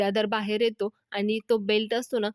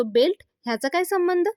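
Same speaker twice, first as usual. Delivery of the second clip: higher, louder baffled question; puzzled surprise; 8 semitones higher, 5 dB louder than usual. Acted. Vidyadhar to Shalaka, who hands him a belt, puzzled. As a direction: react with surprise, pitch jumping up.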